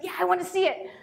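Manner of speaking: retold eager son; eager child. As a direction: pipe up like a keen kid. Eager son's answer, retold.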